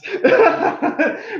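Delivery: a sudden high burst of giggling; high giggle. Real, not acted. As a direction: giggle at your own joke. Giggles at own joke about Scorpio.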